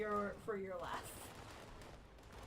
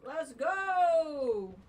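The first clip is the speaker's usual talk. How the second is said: excited cheer; 5 semitones higher, 8 dB louder than usual. Real, not acted. Game hype cheer.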